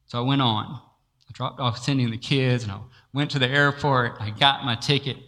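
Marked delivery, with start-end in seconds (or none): none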